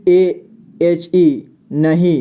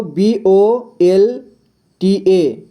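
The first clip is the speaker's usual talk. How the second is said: slow spelling; much slower than usual. Shown as a demonstration. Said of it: "spelling letters slowly".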